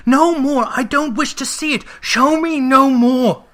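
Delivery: high, loud desperate cry; anguished cry. A narrator's reading. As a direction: shout him off in horror. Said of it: "cried Scrooge".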